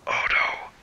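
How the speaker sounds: unvoiced-breath whisper of dread. Real, not acted. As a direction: whisper it as danger appears. Dreads a creature in game.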